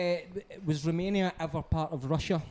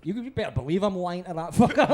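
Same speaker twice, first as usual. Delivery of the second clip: normal talking voice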